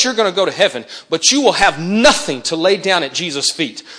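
ordinary delivery

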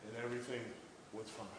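quiet, gentle assurance; soft reassurance. Real, not acted. Preacher softens to reassurance.

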